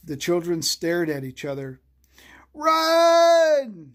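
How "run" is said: high, loud shout. A narrator's reading. Freddie warning the children to flee, loud shout. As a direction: yell the alarm at full force. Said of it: "shouted Freddie".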